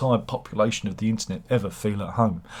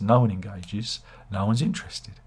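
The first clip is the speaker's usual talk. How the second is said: normal talking voice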